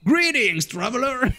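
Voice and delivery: high, exaggerated echo of the greeting; hammed-up mocking mimicry; imitated game vendor. Mimics the vendor's line just played.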